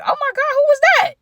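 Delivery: very high, piercing startled cry; shrieking surprise. Retold or imitated. Narrator reliving the front door bursting open, startled shriek.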